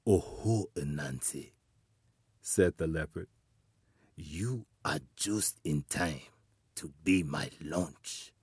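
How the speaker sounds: low, soft leopard voice; panther voice. Acted. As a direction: purr it like a hungry leopard.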